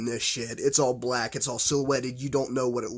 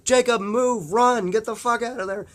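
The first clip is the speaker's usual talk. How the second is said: high, loud, urgent yell to flee; frantic warning shout; 10 semitones higher, 5 dB louder than usual. Retold or imitated. Scientist yelling at Jacob to get out, frantic shout. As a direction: scream at him to run for his life. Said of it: "He's like".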